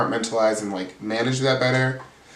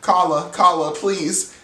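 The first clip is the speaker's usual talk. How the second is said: shaky pleading; 5 semitones higher, 3 dB louder, slower than usual. Retold or imitated. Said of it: "she is shaky when she's upset".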